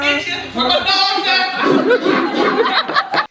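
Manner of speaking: loud, slowly drawn-out shout; loud drawn-out shouted hype. Retold or imitated. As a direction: holler it long like a rapper hyping a crowd. Repeats [name] hype shout.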